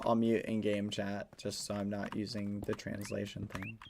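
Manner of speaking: ordinary delivery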